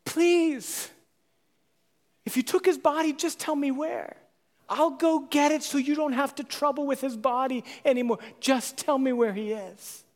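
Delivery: high, pleading voice of a grieving woman; Mary begging the gardener, high and earnest; begging plea. Retold or imitated. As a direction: beg the gardener for the body. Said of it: "She begs him".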